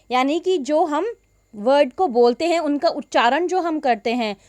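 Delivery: ordinary talk, nothing marked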